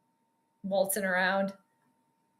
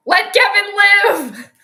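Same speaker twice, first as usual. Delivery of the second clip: loud, high, drawn-out plea; hollered protest, each word stretched; mock-indignant yell; 8 semitones higher, 9 dB louder, slower than usual. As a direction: yell it slowly in playful exasperation. Yelling on behalf of the coach.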